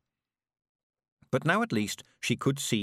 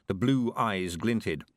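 usual speaking voice

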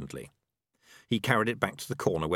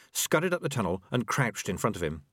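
usual speaking voice

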